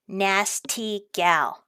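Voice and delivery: nasty gal drawn out very slowly; slow reveal. Shown as a demonstration. Vlogger reveals the plate's meaning to viewers, drawn out. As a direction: savor the punchline slowly. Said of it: "Do you know what that is".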